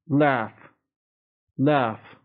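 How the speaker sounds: American "Laugh" with a front A, as in cat; American short-A "Laugh"; American pronunciation. Shown as a demonstration. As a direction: say "Laugh" as laff, American style.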